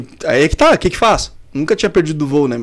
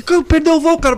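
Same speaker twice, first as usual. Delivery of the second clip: much higher mock boss asking in disbelief; incredulous boss; 9 semitones higher than usual. Boss asking the referee how he missed the flight, incredulous. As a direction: voice the boss, stunned. Boss voiced in retold call.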